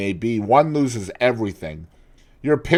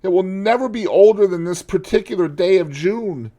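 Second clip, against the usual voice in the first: high, loud despairing cry; anguished lament; 11 semitones higher, 9 dB louder than usual. Acted. Dorian lamenting to the painter, high and anguished. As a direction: pour out his despair, pleading.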